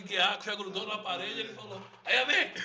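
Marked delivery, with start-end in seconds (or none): 2.0-2.5 high, loud startled cry; sudden high yelp of pain; retold yelp. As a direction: blurt it out, caught by the punch. Kid hit in sparring cries out.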